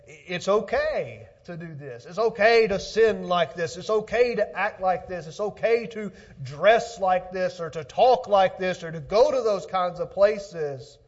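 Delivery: loud, high, driving pulpit delivery; forceful preaching. Acted. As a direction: hammer out each example to the congregation.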